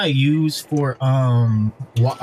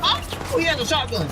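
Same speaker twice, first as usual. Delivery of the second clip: sudden high-pitched alarm; startled callout; 13 semitones higher than usual. Real, not acted. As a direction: yelp it out in surprise. Alarmed spotting shotgun.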